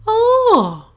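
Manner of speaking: high, loud teacher dragon; a booming schoolmistress dragon calling from the sky; Madam Dragon. Acted.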